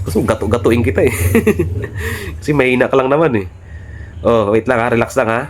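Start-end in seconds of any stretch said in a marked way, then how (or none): 1.2-2.4 laugh. Real, not acted. Mocking enemy.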